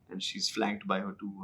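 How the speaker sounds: usual speaking voice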